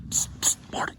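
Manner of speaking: urgent hunting whisper. Real, not acted. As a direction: hiss his name without voice. Hunter signals partner before shot.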